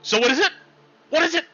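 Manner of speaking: high, pushy and curious; eager buddy. Acted. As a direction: pester him to spill the news. Curious buddy pressing for news.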